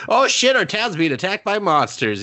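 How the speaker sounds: high-pitched mock panic; mock alarm. Retold townsfolk alarm at monster attack.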